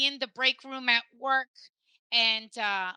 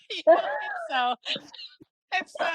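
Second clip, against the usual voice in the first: laughing; faster than usual. Real, not acted. Laughing at her own punchline to a friend. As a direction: break into a big laugh mid-sentence.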